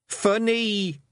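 put-on delighted toddler. A narrator's reading. Wentworth chuckling at Rob, delighted toddler voice.

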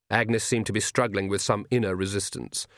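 ordinary talk

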